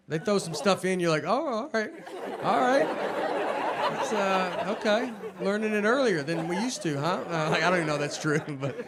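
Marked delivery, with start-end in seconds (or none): 1.2-7.2 higher, hesitant acted acceptance; uneasy surprise. Parent faced with third grade homework, uneasily surprised. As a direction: play a parent unsure but going along.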